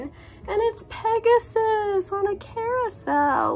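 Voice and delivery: excited. Real, not acted. Excited over pin.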